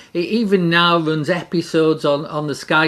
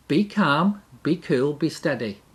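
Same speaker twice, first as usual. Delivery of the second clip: soft reassurance; 4 semitones lower, 7 dB quieter than usual. Acted. Preacher gently calms the congregation, softly reassuring. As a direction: lower and softer, settling down.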